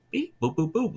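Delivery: high chirpy robot beeps; droid beeps. Retold or imitated. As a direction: beep like a little droid. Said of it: "doing all their noises".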